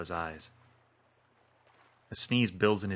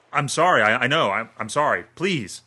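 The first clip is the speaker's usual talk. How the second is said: higher, louder stammering plea; frightened pleading; 5 semitones higher, 10 dB louder than usual. Acted. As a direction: beg for your life, tripping over words. Dan begs gunman for his life.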